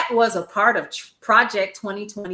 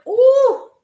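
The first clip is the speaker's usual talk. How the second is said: ordinary delivery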